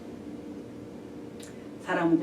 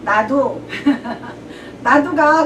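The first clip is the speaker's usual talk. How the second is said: laughing; 3 semitones higher, 6 dB louder, much slower than usual. Acted. Disciples eagerly joining Peter.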